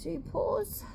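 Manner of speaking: high, soft pet-talk voice; soft high prompt, rising at the end; coaxing a dog. Real, not acted. Coaxing dog to give paws.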